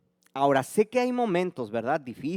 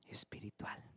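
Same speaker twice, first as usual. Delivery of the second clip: whispered word; 18 dB quieter than usual. Real, not acted.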